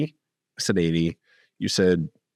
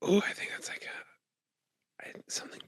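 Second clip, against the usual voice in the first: whisper; 11 semitones higher, 7 dB quieter than usual. Real, not acted. Co-host whispers to host that something bad happened, hushed whisper. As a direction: break it softly under your breath. Hesitant whisper about sad news.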